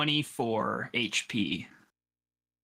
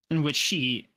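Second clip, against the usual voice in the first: usual speaking voice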